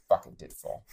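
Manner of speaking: soft under-the-breath admission; muttered curse, grudgingly owning up. Retold or imitated. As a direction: mutter it, caught out. Admitting spill, grudging.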